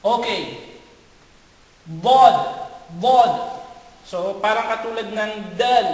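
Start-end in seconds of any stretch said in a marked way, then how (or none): 1.9-3.6 Bod said thick, mouth full of air; heavy Arabic dad, full mouth; emphatic Arabic dad. Shown as a demonstration. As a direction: puff the cheeks for Bod. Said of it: "naglalagay ka ng hangin dito sa loob".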